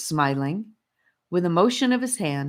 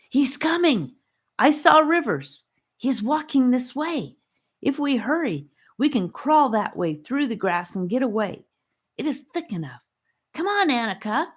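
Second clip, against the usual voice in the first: put-on high, hurried kid's voice, urgent; a boy rushing to warn his friends; urgent boy Charlie; 9 semitones higher than usual. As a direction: kid spotting danger, pitched high and urgent.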